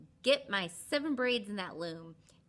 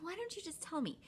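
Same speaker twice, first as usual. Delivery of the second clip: higher, softer pleading; 11 semitones higher, 5 dB quieter than usual. Retold or imitated. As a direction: beg him sweetly. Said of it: "so she's like".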